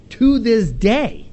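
to this day slowed right down and pressed hard; stress on to this day. Shown as a demonstration. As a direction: lean on every word of to this day, slow and firm. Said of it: "tacks on this little comment at the end".

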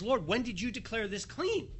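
mock shocked Peter asking about ostrich; incredulous question. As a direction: ask it amazed, with a laugh before the last word. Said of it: "what's shocking here is".

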